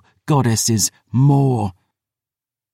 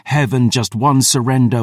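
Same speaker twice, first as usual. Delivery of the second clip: ordinary delivery, nothing marked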